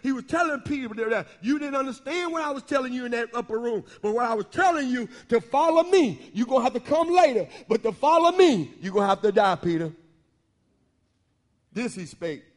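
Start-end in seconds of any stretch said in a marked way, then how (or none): 4.0-9.9 full-force preaching. Acted. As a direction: drive it home like a pulpit climax. Sermon climbs to full force.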